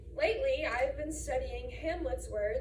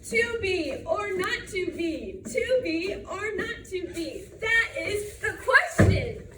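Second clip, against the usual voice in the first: loud, rhythmic cheer chant; cheerleader; 3 semitones higher, 6 dB louder than usual. Acted. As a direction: chant it at a pep rally, stretching the beats. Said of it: "My brother is a cheerleader".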